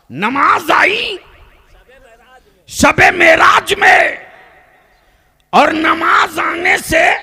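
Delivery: high, loud, stretched-out chant-like shout; full-force preaching. Real, not acted. Preacher builds his point for the congregation, full-force preaching. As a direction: proclaim each phrase slowly at full power.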